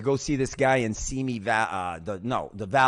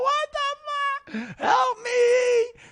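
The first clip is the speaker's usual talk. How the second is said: high, drawn-out acted-out cry for help; freaking out; 5 dB louder, much slower than usual. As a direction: wail it in panic, rising to a shout. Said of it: "when I'm freaking out".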